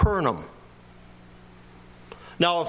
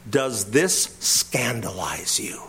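slow and low, weighing each word; slow quoted question; 7 semitones lower, 4 dB quieter, slower than usual. Shown as a demonstration. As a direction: let "Does this scandalize you?" sink in slowly and low. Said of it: "That's the direct word from the Greek".

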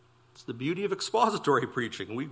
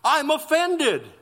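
mock offended; 9 semitones higher, slower than usual. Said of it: "you're usually saying".

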